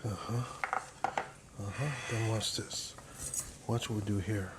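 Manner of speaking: normal talking voice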